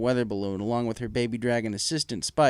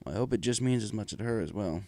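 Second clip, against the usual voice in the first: soft hopeful father; 3 semitones lower, 7 dB quieter than usual. Acted. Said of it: "he said".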